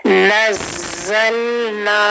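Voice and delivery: नज़लना slow with the shadda held; slow recitation; tajweed recitation. Shown as a demonstration. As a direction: hold the ज़ in नज़लना. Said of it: "उसको हमने थोड़ा सा hold करना".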